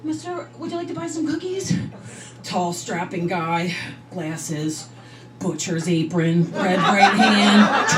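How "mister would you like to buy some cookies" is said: put-on little girl voice. Little girl selling cookies at door.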